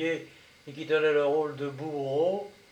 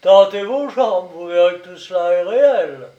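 sneering; 8 dB louder than usual. A narrator's reading. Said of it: "Le commandant ricana".

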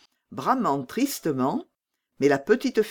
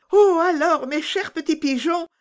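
higher, louder, near tears; pleading; 13 semitones higher, 5 dB louder than usual. Acted. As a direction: beg the pigeons with a catch in the voice. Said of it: "comme pour les implorer".

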